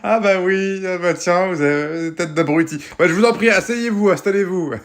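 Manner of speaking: sarcastic high waiter voice, rude underneath; mock-polite waiter. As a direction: play a cheeky restaurant waiter. Waiter sketch, sarcastic host.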